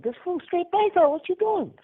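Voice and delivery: high, far-off mock voice of the paisas. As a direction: squeal it like the older guys judging. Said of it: "the paisas are looking at me like".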